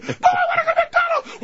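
put-on very high, wailing child; screaming kid. Kid begs parent for McDonald's, screaming. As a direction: shriek a child's demand. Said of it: "their eight screaming kids".

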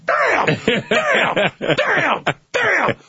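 high frustrated cries of damn; high, exasperated game show loser. Pretend. Frustrated contestant loses.

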